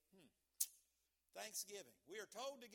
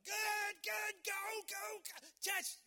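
high, full-out sports fan shout; frantic cheering yell; yelling at the television; 14 semitones higher, 14 dB louder, slower than usual. Acted. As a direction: holler at the game like a fan. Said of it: "occasionally we yell at the television like".

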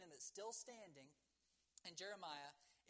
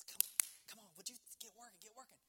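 impatient pleading; 3 semitones higher, faster than usual. Acted. Man shaking the broken barometer.

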